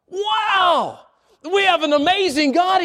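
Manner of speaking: a high, wondering wow; amazed wow. Real, not acted. Preacher to the congregation, an amazed wow. Preacher marvels at God's works.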